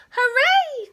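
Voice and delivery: very high, loud little cheer; joyful cheer. Acted. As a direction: squeal with delight. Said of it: "cried the mice".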